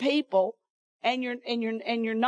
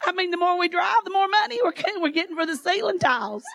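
laughing excitedly; 7 semitones higher than usual. Real, not acted. Preacher to congregation about the growing gifts, laughing with joy. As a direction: laugh it out, overjoyed. Thrilled at growing gift money.

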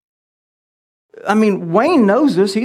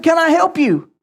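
intimidating; 7 semitones higher than usual. Retold or imitated. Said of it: "In my most intimidating voice I have".

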